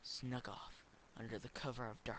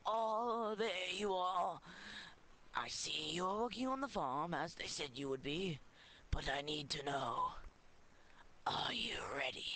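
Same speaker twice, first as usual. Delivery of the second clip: put-on old wizard voice; 6 semitones higher, 4 dB louder than usual. Wizard addresses potato.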